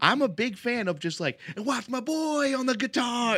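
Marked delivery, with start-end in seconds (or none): 1.6-3.4 high-pitched mock stage announcement; hype shout-out. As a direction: shout out the band member like a frontman.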